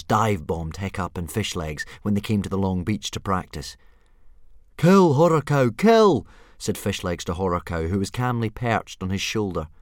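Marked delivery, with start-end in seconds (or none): 4.8-6.3 high, loud order to attack; urgent shouted command. A narrator's reading. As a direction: egg on a hunting beast, loudly. Urging a dragon to hunt.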